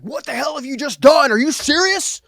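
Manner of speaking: high, loud, angry scolding; dad scolds his son for ruining the deal, angry and high; mad dad. Retold or imitated. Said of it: "He's mad".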